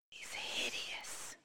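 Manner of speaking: fast, unvoiced spiteful whisper; catty whisper. Farmyard duck sneering at the newcomers, fast whisper. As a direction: whisper a snide aside.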